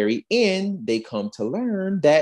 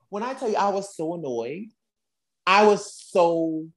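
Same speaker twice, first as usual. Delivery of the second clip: normal talking voice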